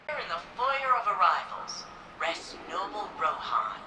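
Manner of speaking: formal guide voice, measured and proud; museum tour narration. Acted. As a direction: welcome visitors like a recorded exhibit guide. Recorded memorial tour resumes.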